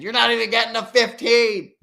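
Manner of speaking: mock outburst. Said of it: "He said".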